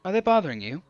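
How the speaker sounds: politely concerned. A narrator's reading. Maya asking the foreigner about the men, carefully polite. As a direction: try to sound as polite as can be. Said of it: "trying to make my voice as polite as Kim's".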